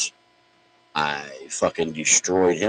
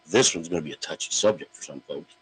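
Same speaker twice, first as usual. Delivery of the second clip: ordinary talk, nothing marked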